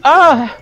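sharp high yelp; imitated pain yelp. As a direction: yelp like you're shot. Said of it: "he went".

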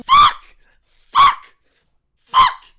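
very high, loud outburst of swearing; furious, separate screamed curses; ill-tempered screaming. Real, not acted. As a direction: lose your temper and shriek it. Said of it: "that was a bit tempered".

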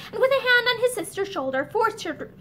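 plain reading voice

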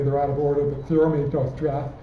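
ordinary delivery

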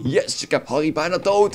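villain gloat. Retold or imitated. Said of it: "En dan denkt hij".

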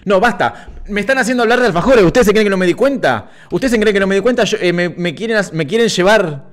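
high-pitched, playful outburst at being baited; mock indignation. Mock outrage at chat's alfajor bait. (indignación fingida, con voz aguda)

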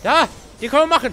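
high loud battle call; excited shout. Real, not acted. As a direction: shout it out, fired up.

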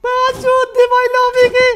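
very high, drawn-out full-force scream; real explosive gamer yell; screamed outburst. Real, not acted. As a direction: scream it at the top of your voice. Explosive outburst at game result.

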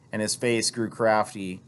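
ordinary delivery

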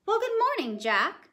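high, cheery stranger's greeting; a sly old man sing-songing good morning; lightly voiced funny old man; funny-looking man. A narrator's reading. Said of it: "said he".

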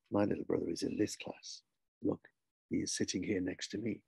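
low, quiet, measured insistence; firm, stubborn boy. Acted. Boy correcting his teacher about his brother, firm and low. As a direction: stand your ground softly and deliberately. Chinggis firmly contradicts the teacher.

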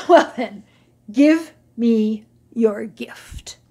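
slow, mock grand; grandly. Camel accepting the goats' gift, mildly grand. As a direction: play the great benefactor. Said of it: "Aloud he said grandly".

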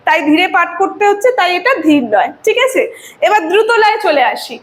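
ordinary talk, nothing marked

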